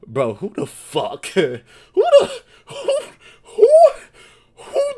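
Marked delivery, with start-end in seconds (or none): 1.9-4.9 squeaky laugh breaking the words; high laughing disbelief; laughing squeak. Real, not acted. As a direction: pitch shooting up through laughter. Cracking up at the question.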